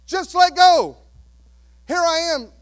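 put-on loud, high call to a scared child; father calling up from the pool, loud and high. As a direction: shout up encouragement from the pool below.

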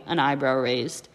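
usual speaking voice

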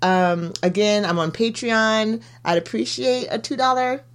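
upbeat sign-off plug; podcast outro plug. Acted. Host asks listeners to follow and donate, as an upbeat outro. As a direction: sell the podcast warmly.

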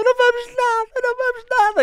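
high, loud shout; frantic reassuring shout. Retold or imitated. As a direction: yell back over the kid's panic. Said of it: "E ela". (colega a gritar à criança, aflita)

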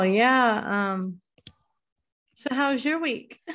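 normal talking voice

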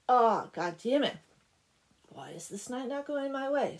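loud mock outburst, then high complaint; exasperated. Devil whose night is going wrong, exasperated outburst. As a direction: burst out in hammed-up frustration. Devil's plans are foiled.

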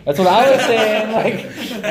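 loud, high outburst through laughter; laughing, vindicated exclamation; excited agreement. Real, not acted. Excitedly agreeing amid shared laughter.

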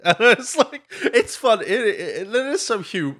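high, giggly, stumbling speech; amused squeal, words tumbling out; laughing while talking. Real, not acted. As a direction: try to explain through real laughter. Cracking up at the film.

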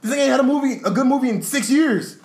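loud, high-pitched indignant rant; mock outrage. Acting out outrage at the rival.

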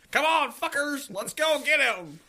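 high, loud rallying yell; mock mob rallying. Co-host playing a pitchfork mob's leader, hammed-up rallying shout.

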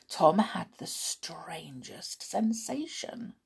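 slow breathy whisper; hushed narration. A narrator's reading. Narrator building suspense in a slow whisper. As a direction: whisper it like a secret.

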